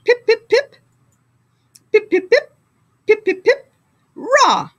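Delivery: squeaky little pips, high up; tiny high pips. Acted. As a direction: peep like tiny penguins. First team's dainty cheer.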